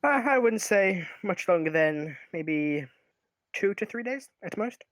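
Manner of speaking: a lightly voiced halfling merchant, unhurried.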